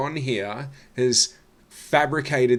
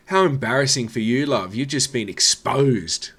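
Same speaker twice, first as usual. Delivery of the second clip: loud, higher-pitched gloating call-out; mock outrage; 3 semitones higher, 6 dB louder than usual. Reactor tells the comedian she's been exposed, mock outrage. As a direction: scold with hammed-up scandal, pushing louder.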